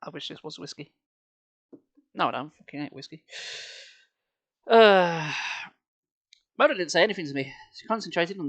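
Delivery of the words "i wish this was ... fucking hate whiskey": muttered aside. Real, not acted. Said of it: "aside while reading".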